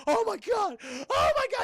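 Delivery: very high, loud panicked shriek; mock terrified scream. Thug screaming in terror at Scarecrow, high shriek. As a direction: shriek like you just saw a monster.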